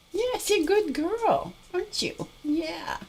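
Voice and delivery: very high cooing praise. Real, not acted. Cooing at dog.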